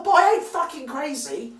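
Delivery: furious shout. Real, not acted. Ranting woman exploding at the podcaster, shouting. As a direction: blast it out in fury. Furious outburst ending rant.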